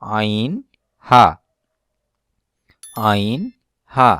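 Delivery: আইন and হা pressed from the middle of the throat; throat letter demo. Shown as a demonstration. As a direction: sound out আইন, হা from mid-throat. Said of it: "কণ্ঠনালীর দ্বিতীয় ভাগ হতে উচ্চারণ হয়".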